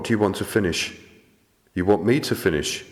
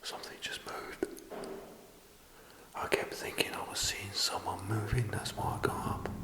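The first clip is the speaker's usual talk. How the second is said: hushed, tense whisper; 14 dB quieter than usual. Real, not acted. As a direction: keep it barely audible in the dark. Scared ghost hunter whispering.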